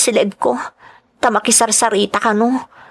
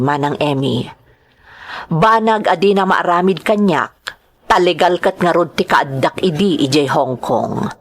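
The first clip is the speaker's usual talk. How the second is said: lower, reflective narration; inner monologue; 4 semitones lower than usual. Acted. Maid reflects on the abusive overseer, low reflective narration.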